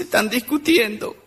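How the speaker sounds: mock-tearful child voice; tearful little girl. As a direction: play a little girl whimpering through her words. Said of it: "Estaba una chiquita llorando en la plaza".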